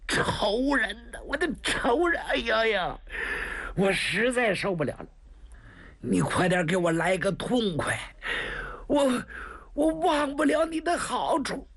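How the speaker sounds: high, strained cries of pain and begging; anguished pleading. Acted. Wounded man crying to 白公子 about his enemy, anguished. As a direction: cry out for a quick end.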